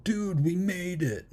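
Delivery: high, drawn-out celebration; stretched-out retold triumph in a high voice; mock excited. Acting out survivor's relieved celebration.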